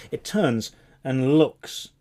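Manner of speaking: ordinary delivery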